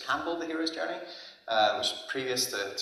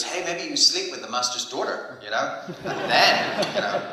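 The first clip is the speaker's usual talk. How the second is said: put-on a light German accent, higher and laughing through it; 8 semitones higher than usual. Retelling Eric's joke about the master's daughter, lightly German-accented and laughing. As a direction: tease like Eric with a German lilt and a chuckle. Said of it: "you can do the German accent".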